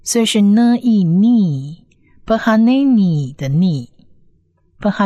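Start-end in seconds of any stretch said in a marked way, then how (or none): 0.4-1.9 "呢E逆" as n, long drawn ee, then joined as nee; syllable blending. Shown as a demonstration. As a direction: sound out "呢E逆" piece by piece. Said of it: "是长母音E的音". (分步拼读“呢E逆”，长母音拉长后合读)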